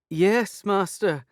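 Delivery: put-on high, loud reply in Turkish accent. A narrator's reading. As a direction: answer dutifully through the door. Fairy answering through door.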